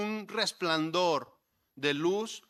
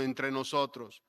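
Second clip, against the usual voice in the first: ordinary talk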